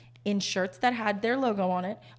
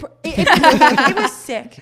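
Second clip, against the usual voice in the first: laughing; 4 semitones higher, 11 dB louder than usual. Real, not acted. Laughing at gross burger.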